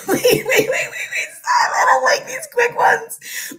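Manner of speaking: high, squealing panic through laughs; real giggly fluster, voice shooting up; flustered laughter. Real, not acted. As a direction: protest helplessly while cracking up. Flustered on quick fire question, laughing.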